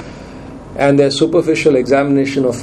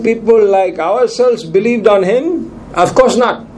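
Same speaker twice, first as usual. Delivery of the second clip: raised, sneering voice of the proud leaders; 4 semitones higher than usual. Acted. Preacher mocking the leaders who dismiss the crowd, sneering and raised. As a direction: scoff at the common people from up high. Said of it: "went something like this".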